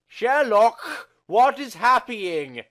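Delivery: high, loud alarmed cry; panicked cry. Acted. John cries out to Sherlock in alarm, high and loud. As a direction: shout it in sudden panic.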